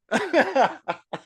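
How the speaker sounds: high-pitched giggle fit; helpless high laugh; burst of laughter. Real, not acted. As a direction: crack up at your own joke. Host cracks up at own joke.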